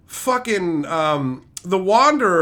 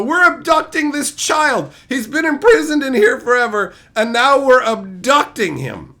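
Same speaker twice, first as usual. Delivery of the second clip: mock heroes; 5 semitones higher, 5 dB louder than usual. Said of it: "and go".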